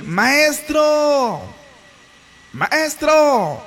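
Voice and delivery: high, stretched-out call; calling out from afar. Acted. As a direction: hail the master across a distance. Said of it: "y le gritaron diciendo".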